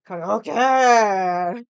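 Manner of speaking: Okay shouted in a mock Japanese accent; loud mock Japanese okay.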